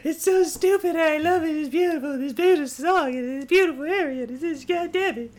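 high-pitched emotional gushing; overwhelmed gushing. Real, not acted. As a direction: gush in helpless adoration. Gushing about the beautiful area.

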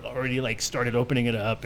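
ordinary delivery

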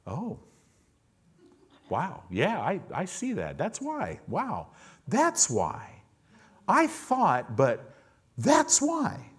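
high, hushed dawning wonder; amazed realization. Retold or imitated. People in heaven finally seeing why, high amazed gasps. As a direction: mimic people finally getting it, breathy awe rising. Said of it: "people walking around going".

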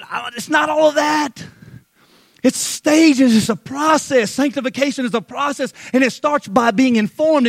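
loud, high, driving sermon delivery; full-force preaching. Acted. Preacher rousing the congregation about Peter's faith, loud and high. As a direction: preach it hard to the back row.

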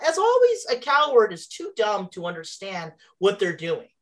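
normal talking voice

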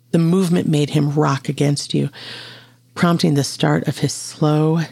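plain reading voice

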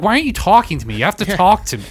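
whiny demanding doctor, hammed up; mock pushy surgeon. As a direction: press the patient loudly to talk back. Surgeon demanding patient chat.

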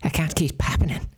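high fussing nanny voice; Mrs. Doubtfire voice. Acted. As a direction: scold like a prim old nanny. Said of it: "a Mister Missus Doubtfire type of voice".

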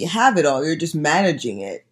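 usual speaking voice

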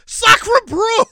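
loud, high French outrage; french accent. Pretend. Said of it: "France should be here, be like".

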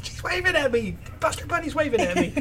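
high, lightly voiced little girl; awestruck little girl. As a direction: squeak it out in amazed disbelief.

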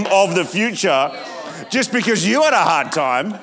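rally the congregation to rejoice; preaching. Acted. Preaching at full force.

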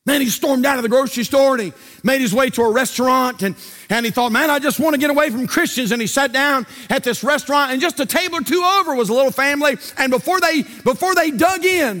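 loud, high, building preacher's cry; preaching at full force. Acted. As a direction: preach it out, voice climbing.

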